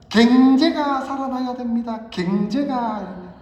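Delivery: 경제가 as 갱제가 in a loud, slow Gyeongsang speech voice; Kim Young-sam impression. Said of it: "옛날에 김영삼 대통령께서 연설을 하실 때".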